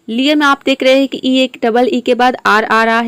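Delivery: usual speaking voice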